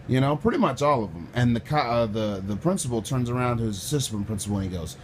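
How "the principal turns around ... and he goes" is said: ordinary delivery, nothing marked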